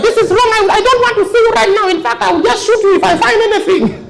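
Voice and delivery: very high, scolding mother voice. Acted. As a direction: scold the drunk husband like an angry mum.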